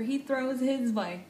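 ordinary talk

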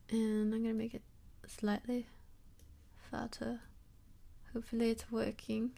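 put-on softly spoken British accent; British accent with dropped r's. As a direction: slip into a playful British accent. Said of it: "I have a British accent right now".

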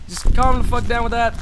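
ordinary delivery

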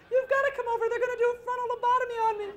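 high, loud, fast frantic call; panicked plea. Retold or imitated. Calling her husband in panic, high, loud and fast. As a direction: beg him to rush over, half laughing.

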